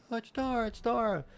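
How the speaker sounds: put-on very high little-girl voice; imitated little daughter. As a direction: mimic a child pleading for Dora. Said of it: "and she's like".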